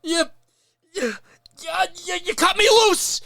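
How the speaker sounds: high, loud yelled Frank voice; Frank Reynolds impression. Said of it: "Frank Reynolds impression".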